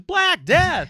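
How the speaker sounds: huge high booming announcer call; loud, high mock announcer shout. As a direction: belt the band name like an arena emcee. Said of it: "He goes".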